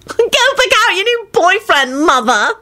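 high, drawn-out squeal; excited teasing. A narrator's reading. Solange teasing her mother, excited.

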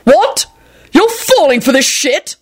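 high-pitched, incredulous outburst; acted yell of disbelief. A narrator's reading. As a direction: stretch it out, aghast and high. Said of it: "Quentin yelled".

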